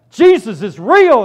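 high, loud, slow shouted proclamation; street shout. Retold or imitated. Stopping an old lady's car to shout the news, loud. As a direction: shout it through her car window.

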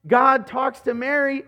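normal talking voice

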